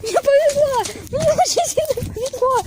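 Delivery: high, squealing with relief; excited. Real, not acted. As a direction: cheer in giddy relief. Monster falls, player rejoices.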